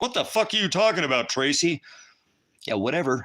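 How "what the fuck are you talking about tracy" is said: high mocking voice of disbelieving friends; mocking doubters. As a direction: squeal it like the people who laughed at you. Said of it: "Everybody's like".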